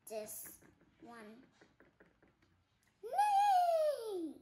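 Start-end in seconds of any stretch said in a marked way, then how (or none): 3.0-4.3 high whinny; horse neigh. Acted. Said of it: "I can do the same thing as horses".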